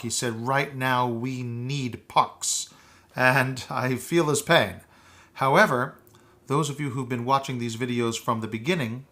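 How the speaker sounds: ordinary talk, nothing marked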